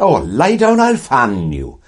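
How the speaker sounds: high, loud South Wales singsong; Welsh relatives. Retold or imitated. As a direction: sing it up like a Welsh aunt in the valleys. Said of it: "My mother's family were from South Wales".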